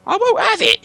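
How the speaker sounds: very high, loud stubborn troll; troll William defiant. Acted. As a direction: refuse like a sulky troll.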